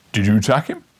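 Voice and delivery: loud gruff constable; stern policeman. Acted. Policeman breaking up the fight, loud and stern. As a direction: scold them like a bobby.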